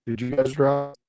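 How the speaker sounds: choppy robotic audio; glitchy call, robotic sound. Real, not acted. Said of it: "that's talking like a robot".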